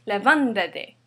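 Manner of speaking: levántate with -ván- punched; stress on -ván-. Shown as a demonstration. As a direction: hit the second syllable of levántate, -ván-. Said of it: "We say levántate".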